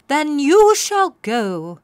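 put-on kindly old magical mare; fairy godmare. A narrator's reading. Fairy godmare greeting crying Poniella, high and grand. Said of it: "the fairy godmare said".

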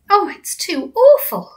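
high, slow mock dismay; a hammed-up cry of horror; Sophie crying out. Sophie reacting to the picnic story, high and slow. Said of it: "Sophie cried".

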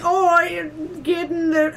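high, slow, botched Irish accent; wandering Irish-to-Jamaican accent attempt; bad Irish accent. Shown as a demonstration. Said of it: "it's like Minnesota to Irish to Jamaican".

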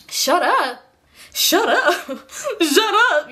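high, incredulous echo breaking into laughter; mock hurt disbelief. Girlfriend echoing boyfriend's insult, mock hurt and incredulous. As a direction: repeat it as if you can't believe he said it.

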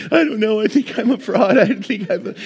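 high, loud, blubbering retelling; mock crying. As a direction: wail it as a comic breakdown. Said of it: "I just start crying".

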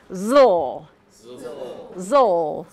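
zl said clearly, tongue behind top teeth; zl sound demo. Shown as a demonstration. Teacher showing zl at the mirror. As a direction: show zl in the mirror. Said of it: "you can look at yourself and say".

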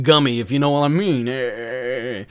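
slow, sly innuendo; suggestive. Acted. Joking to viewers with a slow, sly wink. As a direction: lean into the wink, slowly.

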